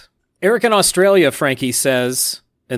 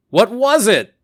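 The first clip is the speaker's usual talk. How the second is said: high, loud quoted holler; retold yell; 9 semitones higher, 6 dB louder than usual. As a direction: yell it out as a big question. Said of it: "who remembered to yell".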